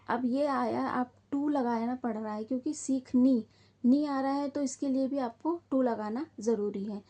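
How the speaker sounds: ordinary talk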